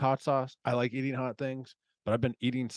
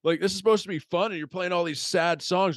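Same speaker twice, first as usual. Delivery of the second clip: higher, louder outraged best man; imitated indignant complaint; 8 semitones higher, 5 dB louder than usual. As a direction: retell an exasperated protest, pitched up high. Acting out best man's complaint.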